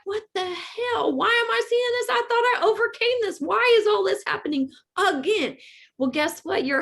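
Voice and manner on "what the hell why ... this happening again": high, incredulous "why me again"; baffled to see old pain return, high and exasperated; exasperated disbelief. Retold or imitated. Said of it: "you're like".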